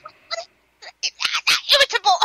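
high, halting mock sputter; too angry for words. Imitating a mother losing her words, mock angry. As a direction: imitate someone groping for the word in a rage. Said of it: "so angry that they forget the word".

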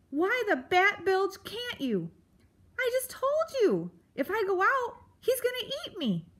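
high, frantic little mouse voice; scared mouse. A narrator's reading. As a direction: squeal it out in a panic. Frightened mouse pleads.

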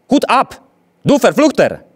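very high, loud enraged German scream; furious scream. A narrator's reading. Enraged gendarme screaming at the defiant father. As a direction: scream it in a rage. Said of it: "zawrzeszczał".

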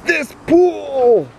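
high wailing mock sob, very slow; fake crying. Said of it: "Look at that".

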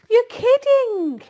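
high, loud, drawn-out squeal of disbelief; astonished disbelief. A narrator's reading. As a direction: squeal it slowly, utterly amazed. Wilbur cannot believe the number.

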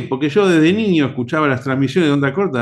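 normal talking voice

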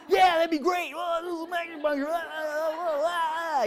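high loud gush breaking into sung ahs; laugh-singing at the fun of acupuncture; giddy excitement. Retold or imitated. Teacher replaying her giddy reply to Ben's offer, high, loud and sing-song. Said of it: "And I'm like".

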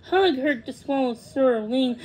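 plain reading voice

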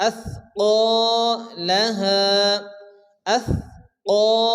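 আথকালাহা with each long vowel held one alif, slowly; stretched vowels. Shown as a demonstration. Teacher demonstrates the stretch in আথকালাহা to students, slow. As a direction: draw out the ka and ha in আথকালাহা. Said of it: "এক আলিফ করে টান দিয়ে পড়তে হবে".

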